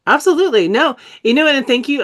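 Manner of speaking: high, loud bursts of enthusiasm; excited exclamation. Real, not acted. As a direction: jump up with delight. Teacher reacting to the chat.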